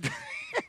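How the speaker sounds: shrill giggle, far above normal; high-pitched helpless cackle; high squealing laugh. Real, not acted. Laughing at the question.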